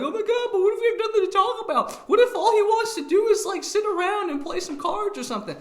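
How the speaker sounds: high, fast mock worried girl with a laugh. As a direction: squeal it as an anxious girl picturing a dull date. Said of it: "you're like".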